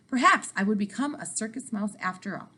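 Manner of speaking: ordinary delivery, nothing marked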